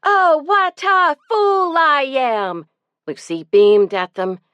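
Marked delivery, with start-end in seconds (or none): 0.0-2.7 high, loud shouted chant; boys shouting. A narrator's reading. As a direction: shout it back like excited kids. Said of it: "the boys shouted back".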